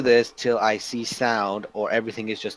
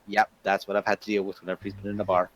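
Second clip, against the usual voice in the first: lower, softer, fast resigned aside; tired, seen-it-all sigh of a line; weary deadpan; 6 semitones lower, 6 dB quieter, faster than usual. Acted. Companion deadpanning to Faith about the drunk friend. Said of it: "look at Faith and goes".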